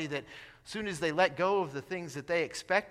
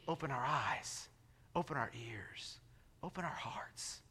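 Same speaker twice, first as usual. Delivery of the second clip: slow, much softer, fading to a whisper; hushed appeal; 3 semitones lower, 11 dB quieter than usual. Real, not acted. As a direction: draw them in, softer with each phrase. Quiet invitation in sermon.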